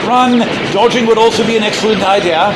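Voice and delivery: high, loud urgent yell while fleeing; panicked loud call to flee; shouted warning. Acted. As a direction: shout it as you run for your life.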